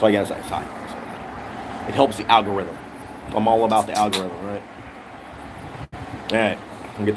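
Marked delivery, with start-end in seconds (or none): 0.0-1.9 soft unvoiced whisper; hushed aside to the host. Real, not acted. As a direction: whisper so the mic barely catches it. Partner whispers off camera.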